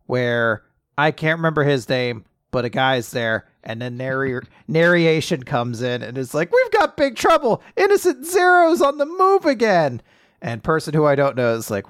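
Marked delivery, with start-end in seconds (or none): 6.5-10.0 high, sustained urgent cry; alarmed announcement. Retold or imitated. Podcast host voicing manga narration, high alarmed cry. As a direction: cry it out like breaking alarm news.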